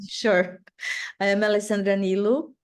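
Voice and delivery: ordinary delivery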